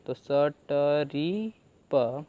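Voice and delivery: स ट री प split into slow separate sounds; slow phonics blending of स ट री प; sounding out slowly. Shown as a demonstration. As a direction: blend स ट री प one sound at a time. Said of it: "पी का हम बोलते हैं प".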